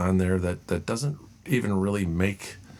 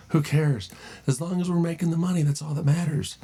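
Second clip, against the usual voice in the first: higher, smug mock company voice; mock greedy executive; 9 semitones higher than usual. Vlogger mocking a corporation, smug greedy voice. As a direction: sneer like a greedy boss. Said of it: "voicing greedy corporation".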